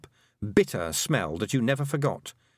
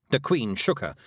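plain reading voice

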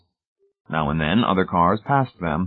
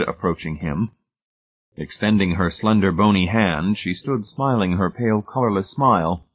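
ordinary delivery, nothing marked

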